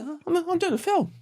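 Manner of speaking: high, squeaky excited quote; amazed high-pitched squeal of joy; giddy disbelief. Retold or imitated. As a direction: gush like you can't believe your luck. Giddy disbelief at filming movie.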